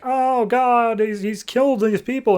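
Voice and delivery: high, shrill-edged panic; horrified onlooker. Acted. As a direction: gasp it out in horror. Crowd of aliens reacting in horror.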